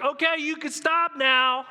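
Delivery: high, loud, slowed plea to make it end; begging to stop. Retold or imitated. Job begs God amid the whirlwind to stop, pleading. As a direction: cry out for the storm to stop. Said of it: "He's like".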